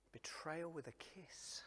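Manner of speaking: hushed whisper, grave. Acted. Preacher to congregation, whispered with weight. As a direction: breathe it like a secret.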